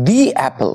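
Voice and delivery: দি as "dee" before অ্যাপল; long "ee" leading into অ্যাপল. Shown as a demonstration. Teacher models the second example, দি said "dee" before অ্যাপল. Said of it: "দু নম্বরের উচ্চারণটা হচ্ছে".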